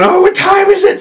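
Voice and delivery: high, loud, frantic question; freaked-out panic. Retold or imitated. Just woken from the nightmare, reaching for the phone: frantic panic. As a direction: blurt it out in a waking panic. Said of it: "Freaking out".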